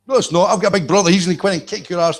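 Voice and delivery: put-on high, whiny kid threat; playground child. Kid threatens rival with big brother, high childish taunt. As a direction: taunt like a five-year-old. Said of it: "This is five-year-old in a playground".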